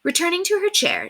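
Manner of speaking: ordinary delivery, nothing marked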